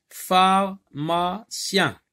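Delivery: Pharmacien very slow and high, in three parts: phar, ma, cien; syllable by syllable. Shown as a demonstration. Teacher splitting a word for pupils, slow separate syllables. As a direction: break "Pharmacien" into three loud, spaced syllables. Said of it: "trois syllabes".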